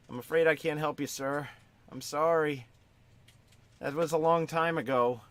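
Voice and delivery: put-on a polite little boy saying sorry; eight-year-old boy. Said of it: "The eight-year-old".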